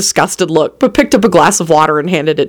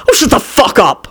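higher-pitched, mild shout; 5 semitones higher than usual. A narrator's reading. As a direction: snap back in annoyance. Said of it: "Quentin shouted at her".

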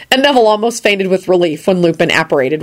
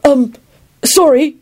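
higher-pitched, hurried apology; flustered babbling; 9 semitones higher, slower than usual. A narrator's reading. Neville apologises to his visitor, flustered babble. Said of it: "he babbled quickly".

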